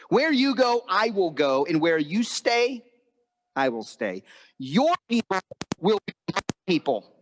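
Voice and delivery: high, deliberate declaration; preacher acting out a fervent oath; impassioned vow. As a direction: proclaim it as a solemn pledge, phrase by phrase. Ruth's impassioned vow of loyalty, acted.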